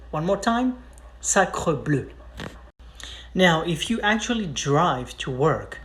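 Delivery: ordinary talk, nothing marked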